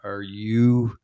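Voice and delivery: drawn-out, mock-stunned question, very slow; hammed-up slow incredulity; slow disbelief. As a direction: stretch every word like you're gobsmacked. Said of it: "That's not the way you said it".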